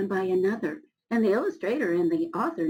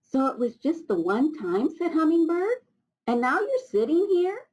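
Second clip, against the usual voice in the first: high, lively creature voices; chirpy forest friends; 8 semitones higher, 4 dB louder than usual. Acted. Forest friends teasing Old Rock in high voices. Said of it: "said Tall Pine".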